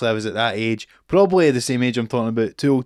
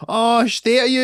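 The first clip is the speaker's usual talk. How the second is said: cheeky mockery; 9 semitones higher, 4 dB louder than usual. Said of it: "take the piss out of the actors".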